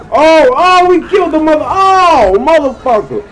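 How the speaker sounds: high, loud shocked yelling; shocked scream. Real, not acted. Running someone over in the game, shocked high scream. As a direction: shriek at the crash.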